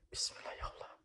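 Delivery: a breathy whispered bismillah; nervous whisper before climbing up; whispered prayer. Real, not acted. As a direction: whisper it, steeling yourself.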